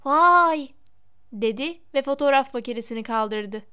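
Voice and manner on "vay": loud, higher awed exclamation; amazed outburst; spellbound wow. A narrator's reading. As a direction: exclaim in fascination. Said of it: "Büyülenmiş gibi bakan Colin".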